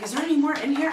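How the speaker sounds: high, loud, surprised question; surprised eager question. Retold or imitated. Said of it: "I was like".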